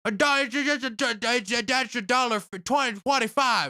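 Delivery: high, loud mock cockney lad yelling Oi mate; British bloke impression. Mocking a British man who corrected him in the store, high loud cockney. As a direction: ham up a shouting British geezer. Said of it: "another British person that came over there".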